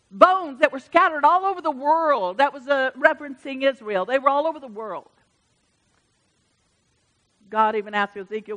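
ordinary delivery

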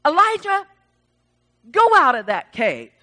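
loud call, high on the name; booming acted summons; God calling out. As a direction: call the name high, then order firmly. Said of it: "when he says".

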